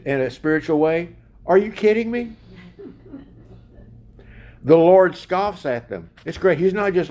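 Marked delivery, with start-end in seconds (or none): 1.5-2.3 high, incredulous scoff; scoffing disbelief. Retold or imitated. God scoffing at rebellious nations, high incredulous disbelief. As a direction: laugh it off as absurd. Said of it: "You know what he's saying?".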